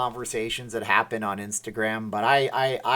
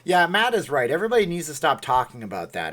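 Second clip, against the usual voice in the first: ordinary talk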